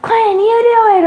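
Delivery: high, loud exasperated snap; tired mom handing off the kid; frazzled mom. Pretend. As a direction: brush the kid off, stressed out. Imagined tired mom snapping.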